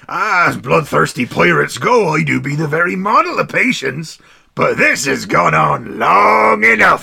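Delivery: pirate captain. Acted. Reading pirate captain's lines.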